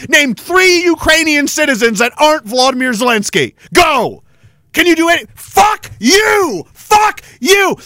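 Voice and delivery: real angry tirade shouted at the camera; yelling. Real, not acted. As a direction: yell it at the politicians. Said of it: "I have to sit here and yell about it".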